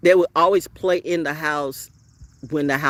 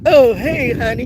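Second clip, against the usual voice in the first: high, loud sing-song mock flirt; hammed-up flirty hello; mock flirty greeting; 8 semitones higher, 7 dB louder than usual. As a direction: toss it off like a fake sweet come-on.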